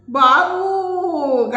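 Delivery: high, loud, long drawn-out wail; landlady crying out to the young tenant. Acted. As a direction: cry out the word like a mother. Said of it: "గట్టిగా అరిచింది".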